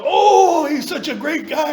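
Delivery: high, laughing rave; high mock praise with a laugh; mock gushing. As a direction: gush like a fan, laughing. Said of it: "everybody was all".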